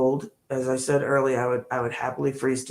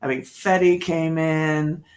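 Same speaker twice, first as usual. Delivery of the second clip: ordinary talk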